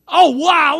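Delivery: high, booming wow of surprise; loud amazement. Real, not acted. Preacher seeing who had CB handles, loud amazement. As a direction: burst out amazed at the response.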